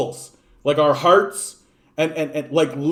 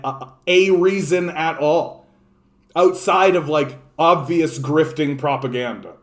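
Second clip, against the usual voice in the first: usual speaking voice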